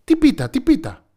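bursting with curiosity; eager boy. Acted. Eager boy asks about pie. (ανυπόμονο παιδί)

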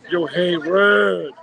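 slow, drawn-out mock call to a girl; mock sleazy call. Wrestler calling out to the storyteller's girlfriend, imitated slow. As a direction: lay on the slow creepy greeting.

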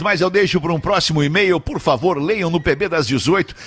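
ordinary talk, nothing marked

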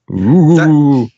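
high, loud spooky moan; ghostly ooh. Acted.

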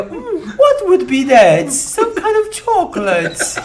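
high, slow prissy British voice; posh British accent. Pretend. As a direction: play a dainty English lady. [name] acted in English.

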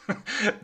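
a quick breathy laugh; a real short burst of laughter; short unvoiced laugh. Real, not acted. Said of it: "I had to laugh".

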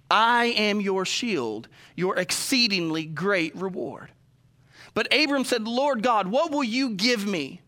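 slow, measured Bible reading; scripture reading. Acted. Pastor reading Genesis to the congregation, solemn and slow. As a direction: read it out like holy writ, deliberately.

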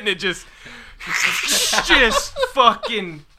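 high-pitched, struggling to speak through laughter; real laughing. Real, not acted. Telling friends how he got thrown up on, laughing. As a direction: crack up and barely get the words out. Cracking up at own vomit story.

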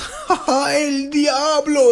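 high, very slow imitation; laugh, then stretch the words out high; classmate's laughing outburst. Imitating classmate teased by teacher, laughing high outburst. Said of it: "when he makes fun of him, he goes".

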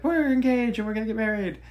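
high, loud gushing announcement; excited Penelope. Retold or imitated. Wife-to-be Penelope blurting out the news to the interviewer, high and gushing. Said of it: "Penelope's like".

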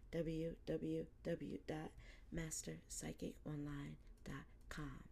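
soft whispered promo read; whispered outro. Acted. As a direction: murmur the sign-off like a secret ad.

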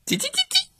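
very high, soft cheeping; soft chick cheeps. Acted. Chicks cheeping softly at teacher.

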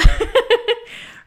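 bright burst of laughter; high laugh. Real, not acted. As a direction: laugh at your own silly voice. Laughs at own toddler voice.

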